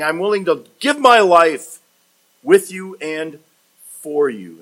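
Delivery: loud, high declaration that settles at the end; emphatic pledge. Acted. Peter offering his life to Jesus, emphatic. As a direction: pledge it forcefully in broken phrases. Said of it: "he says it emphatically".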